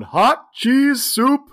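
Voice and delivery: high, loud, drawn-out call; soup carrier calling out. Retold or imitated. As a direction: call it slowly over the crowd. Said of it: "there's this guy walking through".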